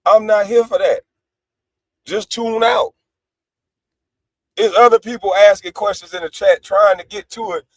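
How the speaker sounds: normal talking voice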